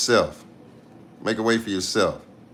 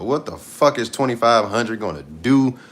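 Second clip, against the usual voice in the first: normal talking voice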